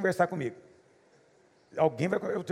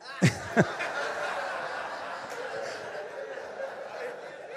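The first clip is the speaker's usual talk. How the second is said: long breathy laugh; 8 semitones lower, 6 dB quieter than usual. Real, not acted. Laughs at his own story.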